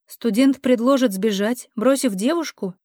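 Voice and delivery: ordinary delivery, nothing marked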